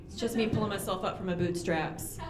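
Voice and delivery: ordinary talk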